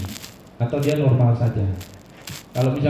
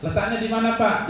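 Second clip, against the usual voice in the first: higher, lightly acted question to a patient; asking the patient; 8 semitones higher than usual. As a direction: ask the patient where it hurts. Acting examiner asking patient. (pemeriksa bertanya pada pasien, nada lebih tinggi)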